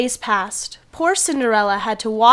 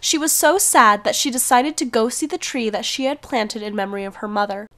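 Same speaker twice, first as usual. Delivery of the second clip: usual speaking voice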